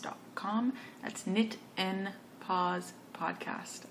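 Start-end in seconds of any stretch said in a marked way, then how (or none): 1.3-3.8 slow, word-by-word "Knit and Pause Podcast"; careful slow repeat of the show's name; slowed right down. Real, not acted. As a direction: say "Knit and Pause Podcast" slowly, each word set apart. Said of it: "I am talking very fast".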